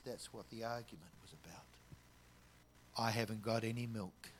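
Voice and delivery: breathy whisper, then tearful; whispered confession. Retold or imitated. Woman telling the stranger the fight's cause, whispered. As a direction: confide it softly through tears. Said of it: "and she says".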